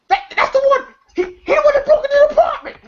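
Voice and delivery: high, fast, shrieking mock cry; Craig's panicked shout. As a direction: yell it like you just spotted the thief. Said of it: "you see Craig go".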